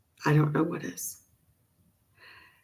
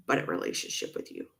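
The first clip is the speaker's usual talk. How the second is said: trailing off into a whisper; 10 dB quieter, faster than usual. Real, not acted. Telling viewers she still wants her mother, dropping to a whisper. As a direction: breathe it out, barely audible. Voice drops while confessing hurt.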